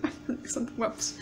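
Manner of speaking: soft, slow, dreamy sigh of a fan; dreamy swoon. Retold or imitated. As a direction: swoon over the handsome actors, hushed. Swooning over actors.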